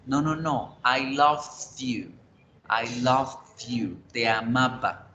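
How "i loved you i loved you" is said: "love" drawn out slowly with its final sound set apart before "you"; past-tense ending stressed. Shown as a demonstration. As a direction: say "love you" slowly as "loved you", separating the words. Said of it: "es muy importante la pronunciación". ("love you" lento, marcando el final de pasado en "love")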